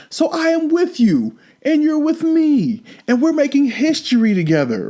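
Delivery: mocking high-pitched imitation of a cocky wrestler; EC3 imitation. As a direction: play him up sweet and high, telling the crowd what they want to hear. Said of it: "He's like".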